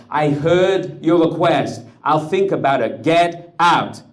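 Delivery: the officer's words shouted back high and loud; screamed mockery. Rebbe screaming the officer's dismissal back at him, high and loud. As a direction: hurl his own words back at him. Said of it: "pleading, screaming".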